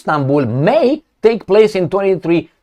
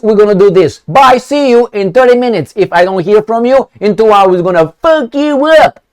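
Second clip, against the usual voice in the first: menacing ultimatum; 6 semitones higher than usual. Pretend. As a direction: bark out the deadline like a gangster. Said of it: "That's not how you talk".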